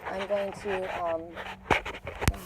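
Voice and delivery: ordinary talk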